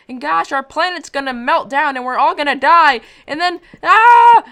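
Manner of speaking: rising loud, high panicked yell; mock panic. Real, not acted. Vlogger spiraling about the planet melting, loud mock panic. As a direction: spiral into a melodramatic scream.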